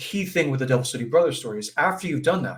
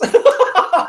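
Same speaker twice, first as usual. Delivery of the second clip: laughter; 11 dB louder than usual. Real, not acted. Laughs at the story's punchline.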